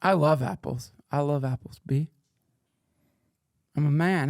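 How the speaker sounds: ordinary talk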